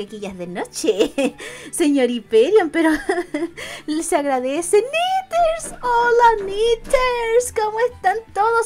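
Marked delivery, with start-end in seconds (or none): 4.8-8.7 high, bright call of the name; happy hello to a regular; high cheery welcome; excited greeting. Real, not acted.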